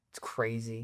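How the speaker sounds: unvoiced, dropped to a whisper. Real, not acted. Fan sighing over the bad movie, whispered. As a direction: breathe it out, deflated. Sudden whisper after venting.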